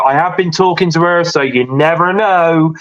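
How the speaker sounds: ordinary talk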